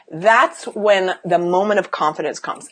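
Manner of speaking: normal talking voice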